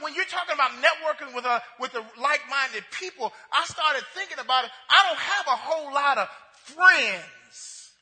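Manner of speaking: usual speaking voice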